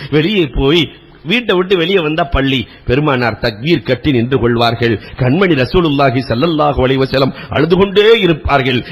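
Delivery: ordinary talk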